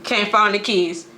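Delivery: normal talking voice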